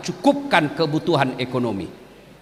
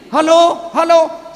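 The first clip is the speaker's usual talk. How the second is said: loud, high hello into the phone; old man shouting hello into a reversed phone; 9 semitones higher, 7 dB louder, much slower than usual. Retold or imitated. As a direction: yell hello as if no one can hear.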